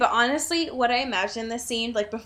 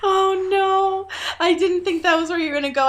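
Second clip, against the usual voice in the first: surprised; 8 semitones higher, 7 dB louder than usual. Real, not acted. Surprised reaction.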